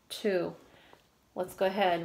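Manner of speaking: ordinary talk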